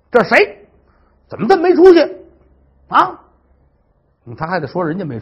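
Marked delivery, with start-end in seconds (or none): 0.1-3.2 high, loud enraged yell; furious outburst. Acted. Stepmother finds the food chewed, furious shout. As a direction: bellow at the greedy thieves, outraged. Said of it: "勃然大怒".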